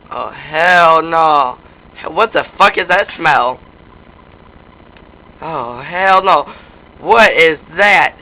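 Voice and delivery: low, loud, slow disbelief; appalled. Pretend. Player finding something foul, loud slow appalled drawl. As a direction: stretch it out low and loud, refusing what you find.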